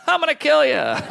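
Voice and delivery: high-pitched sudden comic threat; mock threat. Embarrassed bedwetter to friend on his mattress, high mock threat. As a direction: blurt it high, like a cornered maniac.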